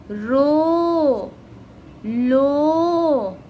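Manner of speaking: तो through शो each drawn out like a chant; sing-song recitation of the syllable rows; reading syllables aloud. Shown as a demonstration. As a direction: slowly model each syllable for the class. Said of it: "फिर आगे".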